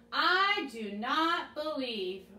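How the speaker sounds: each word drawn out; slow call-and-response as Thomas. Shown as a demonstration. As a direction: lead the kids through Thomas's doubt, slowly. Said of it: "say that with me as Thomas".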